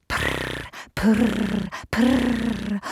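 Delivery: soft rolled cat purrs; three quiet trilled purrs; kitten purring. A narrator's reading. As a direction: purr softly like a kitten. Contented kittens purr at promised pie.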